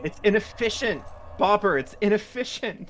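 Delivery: high, smug know-it-all; smug pedant voice. Pretend. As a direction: lecture him like a smug pedant. Teasing friend in a put-on voice.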